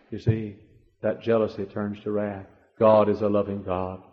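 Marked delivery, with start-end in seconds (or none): none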